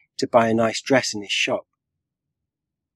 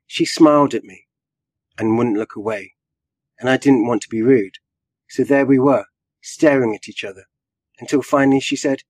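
ordinary delivery

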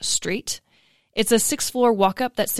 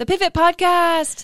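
sing-song echo; 9 semitones higher than usual. Acted. Co-host echoes show name, jingle-like.

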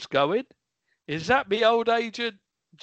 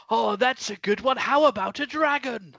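usual speaking voice

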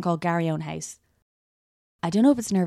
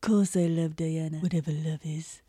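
low, hushed royal impersonation; Prince Charles impression; 4 semitones lower, 3 dB quieter than usual. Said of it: "this is my Prince Charles accent".

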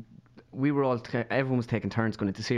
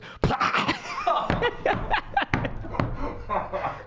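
sudden explosive puff, breaking into laughter; mimed dying splutter; imitated last breath. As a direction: blast out a final breath. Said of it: "Granny just goes".

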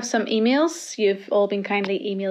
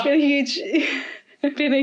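laughing; 6 semitones higher than usual. Real, not acted. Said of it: "laughing at the accent".

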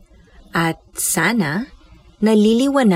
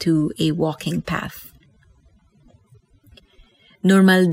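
guided meditation. Acted. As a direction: guide them gently into stillness. Leads breath meditation.

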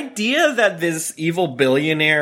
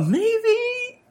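much higher, playful falsetto; teasing sing-song; much slower than usual. Real, not acted. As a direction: toss it up high teasingly.

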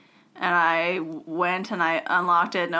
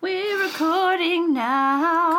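drawn-out announcement; 9 semitones higher, much slower than usual. Acted.

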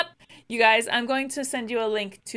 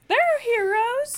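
mock high voice; 12 semitones higher, slower than usual.

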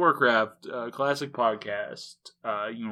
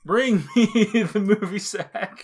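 mock grand command; 5 semitones higher, 4 dB louder than usual.

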